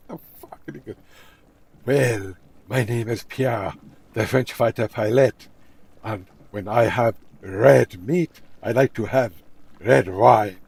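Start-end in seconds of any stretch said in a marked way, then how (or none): 1.8-10.6 louder, slowed, lightly voiced smug pilot; a touch of a cocky French charmer; suave Pierre. Pierre explaining himself to Marie, lightly hammed smug pilot.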